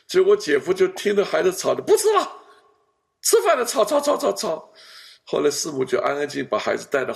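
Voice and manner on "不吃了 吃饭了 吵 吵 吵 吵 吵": high, loud fussing; mock noisy complaint. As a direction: imitate an annoyed in-law griping about noise. Kids noisy, brother-in-law annoyed.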